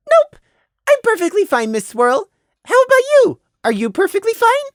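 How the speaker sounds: very high, bouncy little voice; perky child. Acted. Prey turning the question on the mare, perky child voice. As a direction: chirp it like an innocent kid. Fake-innocent child answers brightly.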